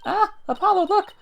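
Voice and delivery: high, loud cry of alarm; startled cry. Acted. Girl cries out to Apollo in high, loud alarm. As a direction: yelp as the reporters appear. Sees reporters outside.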